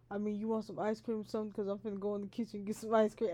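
quick, soft, offhand mumble; mumbled offhand voice. Retold or imitated. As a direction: toss it off casually, quickly. Partner's casual offer acted out.

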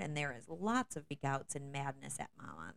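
normal talking voice

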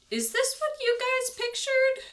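incredulous question. Acted. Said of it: "look at Castra and Pollux and just go".

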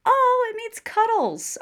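high, slow, drawn-out discovery; amused surprise. Real, not acted. Reading out the emoji meaning to her co-host, amused surprise. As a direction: savor the silly reveal.

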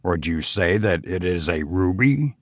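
low, hushed obsessive hunger; fierce avidness. A narrator's reading. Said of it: "a sort of fierce avidness almost obsessional".

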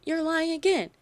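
high-pitched mock outrage; retold freaking out. Audience accuses the hosts on stage, mock freaking out. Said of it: "booing and, like, freaking out".

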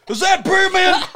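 high, loud hail; calling out. Pretend. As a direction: shout it up the mountain. Calling out on the ski lift.

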